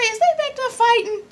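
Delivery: high-pitched, squeaky disbelief; incredulous question. Retold or imitated. Viewer wondering about the group backstage, incredulous. Acting out her suspicious question.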